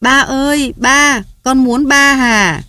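put-on high, slow wailing child voice calling for dad; a frightened little girl stamping and crying out; lightly voiced little girl wailing; child's panicked cry. A narrator's reading. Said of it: "dậm chân hét".

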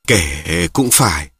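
low, very slow concession; resigned sigh. A narrator's reading. Đoài giving in to his father after a drink, resigned. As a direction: concede it slowly with a sigh's weight. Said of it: "rồi bỗng thở dài".

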